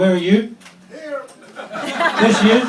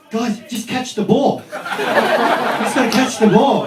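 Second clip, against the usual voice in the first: a higher, slow, laughing mock coach; 5 semitones higher, slower than usual. Australian coach pleading with his handball team, mock. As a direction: play the clueless Aussie coach pleading. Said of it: "the coach is going".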